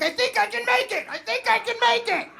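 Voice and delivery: high-pitched straining chant; strained hopeful cry. Acted. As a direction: squeal it with all your effort. Acted straining chant with friends.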